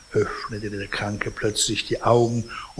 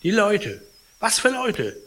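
anxious exchange; 9 semitones higher than usual. Acted. Anxious patient and evasive concierge.

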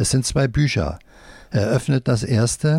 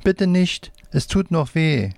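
higher, lightly pleading voice; a touch of begging, pitch raised; mild plea; 7 semitones higher than usual. A narrator's reading. Girl pleads not to be tickled.

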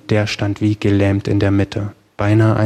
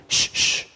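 soft double shush; hushing shush; 5 dB quieter than usual. A narrator's reading. Companion stopping the blond man at the window, softly shushing. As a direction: hush your companion, voiceless. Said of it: "während seine Begleiterin ihm Einhalt gebot".

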